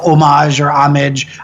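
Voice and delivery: homage as oh-MAHZH, then homage as AH-mij; two pronunciations. Shown as a demonstration. Language nerd listing rival pronunciations, homage both ways. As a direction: homage French-style, then anglicized.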